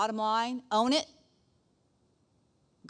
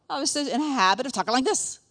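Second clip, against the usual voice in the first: mock frustrated voice, pushed higher; angry habit; 5 semitones higher, 5 dB louder than usual. Shown as a demonstration. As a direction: demonstrate an old habit of angry talking. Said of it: "when I got rid of anger".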